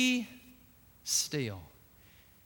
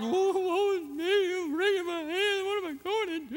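hammed-up "woe is me", a little higher; mock lament; 7 semitones higher than usual. Preacher mocking the fearful believer with a hammed-up wail. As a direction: moan like a hand-wringing mourner. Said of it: "spiritually putting on my funeral garb".

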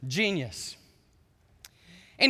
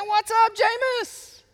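cheerful greeting; 7 dB louder, much slower than usual. Retold or imitated. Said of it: "They say".

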